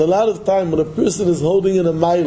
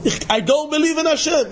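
mock yell; 10 semitones higher than usual. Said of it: "He's yelling".

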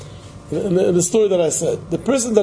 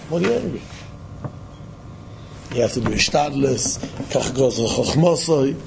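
usual speaking voice

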